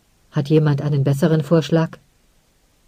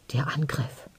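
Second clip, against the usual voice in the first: a soft voiceless whisper; breathed whisper; 3 semitones lower, 13 dB quieter than usual. A narrator's reading. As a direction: barely breathe the words in fear. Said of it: "hauchte jemand".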